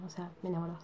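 usual speaking voice

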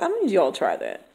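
a quiet, firm word to the class; teacher voice to class. Shown as a demonstration. Teacher addressing her students, quiet and firm. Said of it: "I talk to them like".

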